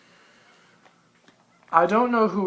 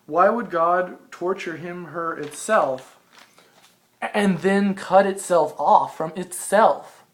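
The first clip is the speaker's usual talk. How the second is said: slow, louder reading of own writing; reading aloud; 4 semitones higher, 6 dB louder, slower than usual. Acted. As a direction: read it out deliberately, slowly. Reads own written passage aloud.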